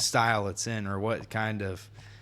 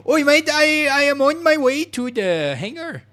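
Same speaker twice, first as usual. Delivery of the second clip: put-on much higher, louder screeching British kid; British kid screaming; 9 dB louder than usual. Four-year-old British kid screaming into the mic, British accent. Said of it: "four-year-old British kid screaming into the mic".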